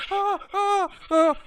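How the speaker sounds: high, loud panicked yelps; frightened squeaks. Acted. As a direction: squeal like scared young dwarves. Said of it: "they're like".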